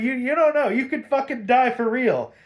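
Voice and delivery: loud, high mock-panicked warning; alarmed warning. Mad Hatter to Bruce on the tower, alarmed warning. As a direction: imitate someone urgently warning a jumper. Villain warns jumper of death.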